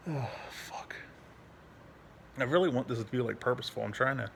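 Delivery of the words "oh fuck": whispered, voiceless swear; sighed curse. Real, not acted. Owner winding down after a dog story, sighed whisper. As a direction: let it out on a long breath.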